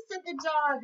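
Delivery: lively storybook voice for little ones; reading to children. A narrator's reading. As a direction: read it like a teacher at story time. Reads picture book to kids.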